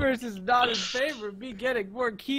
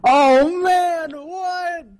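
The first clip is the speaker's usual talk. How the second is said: high, loud disbelief; surprised; 8 semitones higher, 10 dB louder, much slower than usual. Real, not acted. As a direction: react in shocked disbelief. Reacting to a rigged win.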